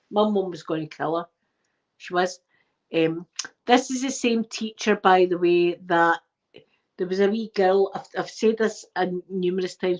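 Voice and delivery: usual speaking voice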